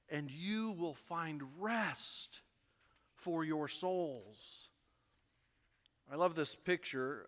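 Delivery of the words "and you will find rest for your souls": slow, softened promise; gentle hush. Acted. Pastor quoting Jesus to stressed listeners, slow and hushed. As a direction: let it settle, softly and slowly.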